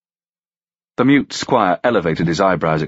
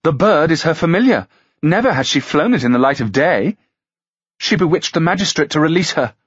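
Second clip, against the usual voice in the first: higher, scandalised court lady; gossiping lady; 8 semitones higher than usual. Acted. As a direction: spread the rumour breathlessly. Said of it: "another offered".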